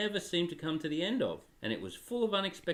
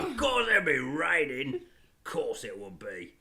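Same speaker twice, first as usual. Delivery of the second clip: Cockney grumble. Acted. Father voicing Edmund as a grumbling Cockney man. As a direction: moan it in a weary Cockney. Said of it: "becoming Cockney".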